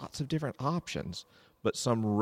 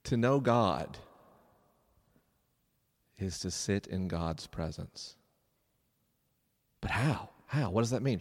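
usual speaking voice

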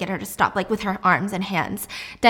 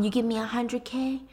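sly bribe whisper; 8 dB quieter than usual. Retold or imitated. Mom demanding bribe from parent.

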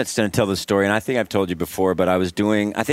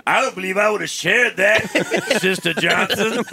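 much higher, amused scolding through laughter; laughing retort; 11 semitones higher than usual. Retold or imitated. Teller answers Sister Johnson, laughing mock rebuke. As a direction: tease her while cracking up.

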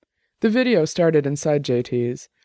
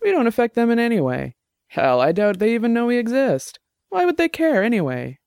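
lightly voiced Max, higher and breezy; easygoing friend; 9 semitones higher than usual. Max brushing off Jenny's worries, lightly voiced and breezy. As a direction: shrug it off.

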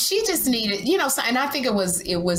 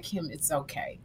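soft, lower comforting voice; supportive friend; 5 semitones lower, 11 dB quieter than usual. Retold or imitated. Friend consoling a crying Kim, soft reassuring voice. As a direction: drop down low and soothe her. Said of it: "my friend thing".

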